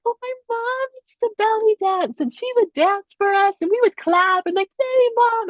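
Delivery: high, gushing imitation; cute excited retelling. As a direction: gush delightedly in a high voice. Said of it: "it was so cute".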